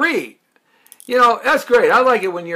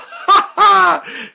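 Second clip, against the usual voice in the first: big laugh; 5 dB louder than usual. Real, not acted. Laughs at own sales plug.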